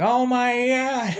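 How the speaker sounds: high, slow shriek of shock; shocked cry. Retold or imitated. April waking to a rat, shrieking in shock. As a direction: gasp it out as if you just woke beside a monster. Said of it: "and she's like".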